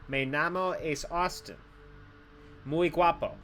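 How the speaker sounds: slow, clumsy American-accented Spanish; broken Spanish. Shown as a demonstration. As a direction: show off broken Spanish word by word. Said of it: "I speak broken Spanish".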